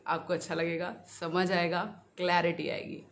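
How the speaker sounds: usual speaking voice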